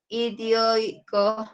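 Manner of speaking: "Idioico" very slowly, each vowel drawn out; "Idioico" in slow, careful teaching pronunciation; sounded-out word. Shown as a demonstration. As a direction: stretch "Idioico" out piece by piece for students. Sounds out example word for vowel analysis.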